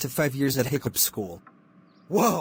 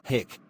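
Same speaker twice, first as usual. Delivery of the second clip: hiccup; 2 semitones lower, 4 dB quieter than usual. Acted. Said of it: "I think you have the hiccups".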